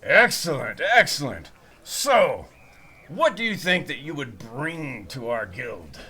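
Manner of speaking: loud, pleased recruiter voice; a pleased mercenary sizing up a recruit; half-orc recruiter. Acted. Half-orc recruiter keeps talking.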